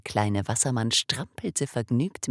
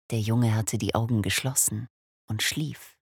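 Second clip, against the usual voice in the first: usual speaking voice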